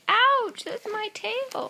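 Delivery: high, slow yelp of pain; squealing tiger. Acted. Tiger bitten in the fight, high mock yelp. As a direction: squeal as your tail is bitten.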